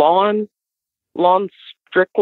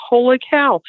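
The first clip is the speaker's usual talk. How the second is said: amazed; 9 semitones higher than usual. Retold or imitated. Wife reacting to the story.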